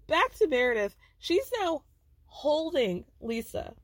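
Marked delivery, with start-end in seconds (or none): none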